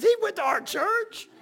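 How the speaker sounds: very high, drawn-out incredulous question; imitated disbelief. Mike reacts to the odd man at church, mock incredulous. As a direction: squeal it slowly in mock disbelief. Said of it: "And Mike goes".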